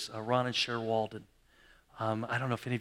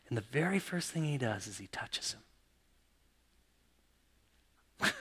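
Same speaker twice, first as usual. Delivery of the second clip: soft whisper building suspense; hushed reveal; 2 semitones lower, 7 dB quieter than usual. Real, not acted. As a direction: lean in and whisper it.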